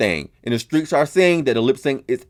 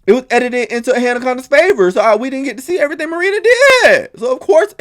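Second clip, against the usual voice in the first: higher, heated insistence on the edit; worked-up rant; 11 semitones higher, 3 dB louder than usual. Real, not acted. Host complaining to viewers about editing, heated. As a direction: argue the case like it's unfair.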